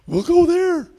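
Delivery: very high, thin demon voice; hammed-up high demon plea; squealing demons. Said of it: "demons, they send us into the pigs".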